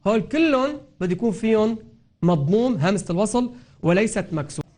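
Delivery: ordinary talk, nothing marked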